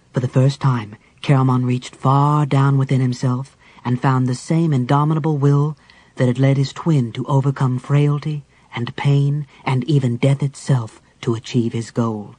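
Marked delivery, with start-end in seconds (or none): none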